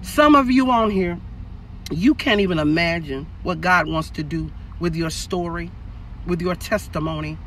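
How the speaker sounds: hushed prophetic word to the listeners. Real, not acted. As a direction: speak it like a quiet prophecy over them. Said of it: "in my prophetic voice".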